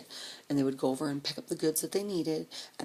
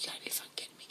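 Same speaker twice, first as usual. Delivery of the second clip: a softer, hushed you gotta be kidding me; whispered disbelief; 11 dB quieter, much faster than usual. Retold or imitated. Eunice hearing the story, whispered disbelief. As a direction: breathe it out in disbelief.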